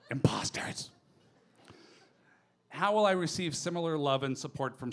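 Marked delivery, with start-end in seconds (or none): 0.1-0.9 hoarse, voiceless mock accusation; jokey, unvoiced jeer at the crowd. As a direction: call out the pretenders in a husky stage rasp. Mocks audience pretending not to know.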